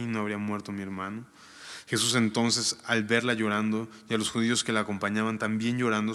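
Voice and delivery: formal reading of the Gospel; scripture reading. Acted. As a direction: read the passage out to the congregation. Reads scripture aloud.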